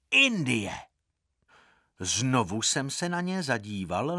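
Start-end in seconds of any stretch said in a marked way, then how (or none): 0.1-0.7 put-on boys' loud answer, pitched up; strong-voiced reply. A narrator's reading. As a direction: call it back high and firm. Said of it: "odvětili jednohlasně silným hlasem".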